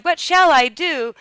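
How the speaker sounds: high, loud wail of dismay; storybook dismayed exclamation; crying out. A narrator's reading. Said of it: "she cried".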